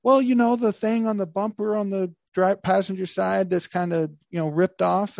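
a hint of high sheepish friend; mildly high mock friend excuse; voicing Dan. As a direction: lightly play the guilty buddy. Dan voiced in retold story.

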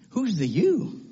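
usual speaking voice

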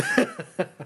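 loud, high embarrassed laugh; high squealing laugh. Real, not acted. Embarrassed about own choice.

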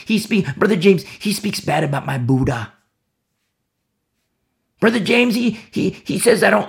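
high, whining complaint about Brother James; whiny offended churchgoer. Acted. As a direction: pout like a sulky child who got told off. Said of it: "That's what worldly sorrow does".

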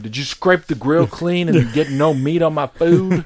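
dad interrogating the waiter about meat on his food; demanding dad. Retold or imitated. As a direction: grill the waiter, slowly.

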